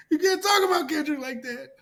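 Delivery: high, whining mock protest; mocking upset fan. Mocking fans defending [name]. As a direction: whine it like an offended fan.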